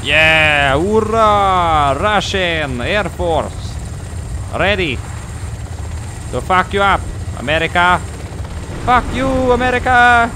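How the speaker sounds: loud, high hammed-up Russian accent; mock Russian pilot. Gamer voicing the Russian chopper pilot in a hammed-up Russian accent. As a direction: cheer like a gloating Russian pilot. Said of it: "the Russians called in a chopper".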